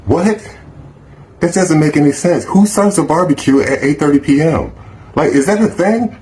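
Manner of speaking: can't believe what he's hearing; high-pitched outrage at the invite; incredulous. Real, not acted. Outraged at 8:30 barbecue.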